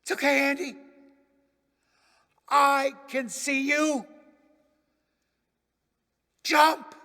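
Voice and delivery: a dad calling up, steady and urgent; father shouting back. Acted. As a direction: coax the boy to jump. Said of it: "Daddy shouts back".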